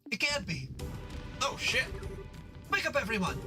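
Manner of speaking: high, urgent alarm; alarmed matron. Acted. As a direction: wake them in a panic. Matron discovers fire.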